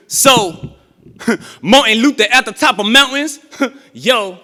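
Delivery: rhythmic, rising poetry performance; spoken word with a chanted refrain; spoken word poem. Acted. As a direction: build it like a sermon in verse.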